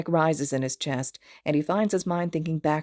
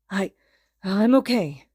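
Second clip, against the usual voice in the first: gasping; 3 semitones higher, 4 dB louder than usual. A narrator's reading. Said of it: "he gasps".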